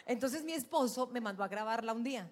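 ordinary talk

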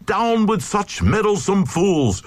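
high angry crowd shout; crowd cry. A narrator's reading. Glugs crying down the Glug with a cast, high. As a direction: jeer the meddler down. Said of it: "the Glugs cried".

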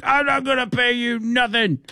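high-pitched put-on Indian accent, drunk passenger; imitated Indian accent. Driver mimics drunk passenger refusing the fare in an Indian accent. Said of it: "Indian accent".

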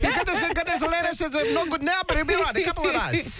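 high, fast schoolgirl voice; high-pitched kid quote, rushed and giggly; Kylie Mole impression. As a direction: squeak it out like a cheeky schoolgirl. Mimicking Kylie Mole letter.

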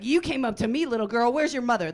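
retold outburst, loud and affronted; indignant retort. Comic telling off a little girl at the playground, indignant.

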